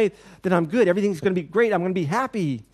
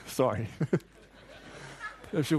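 usual speaking voice

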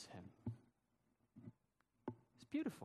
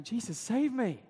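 higher, louder desperate plea; cry for help; 7 semitones higher, 7 dB louder than usual. Acted. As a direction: call out to be rescued. Peter sinking, crying out.

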